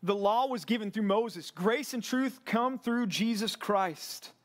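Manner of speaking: fervent preacher rising up over the congregation; full-force preaching. Real, not acted. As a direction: preach it out to the men, building conviction.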